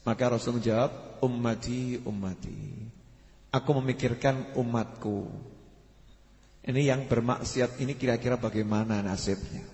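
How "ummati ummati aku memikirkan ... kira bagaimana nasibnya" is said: very soft, low retold lament; sorrowful worry. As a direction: voice a hushed, heavy concern. Prophet's sorrowful answer, re-enacted.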